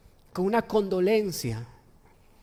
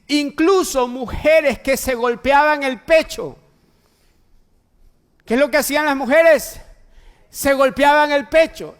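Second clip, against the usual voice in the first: raised, louder preacher's delivery; forceful preaching; 6 semitones higher, 8 dB louder than usual. Acted. Preacher reading the Passion to the congregation, forceful preaching. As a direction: proclaim the passage to the congregation, high and strong.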